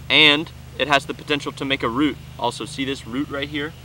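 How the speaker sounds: ordinary talk, nothing marked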